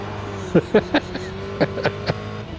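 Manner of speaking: soft, high chuckle; breathy laugh at one's own joke; quiet giggle in short bursts. Real, not acted. Laughs after own mock proclamation.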